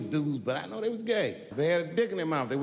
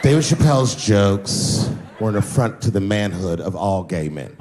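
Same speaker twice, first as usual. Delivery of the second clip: lower, prim, pompous blogger; made-up blogger voice; 7 semitones lower, 4 dB louder than usual. Retold or imitated. Mocking a blogger who attacked him, made-up voice. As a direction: lecture like an offended blogger. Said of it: "I'm just making this voice up".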